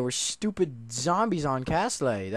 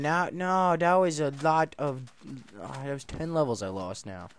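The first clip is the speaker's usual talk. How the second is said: ordinary talk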